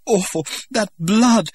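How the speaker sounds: very high, loud, shuddering cry; horrified sob. A narrator's reading. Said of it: "she shuddered".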